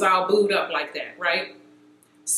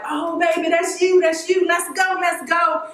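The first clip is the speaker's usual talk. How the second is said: high, sustained squeal of delight in words; mock an overjoyed wife urging her husband on; imitated excited wife; 7 semitones higher than usual.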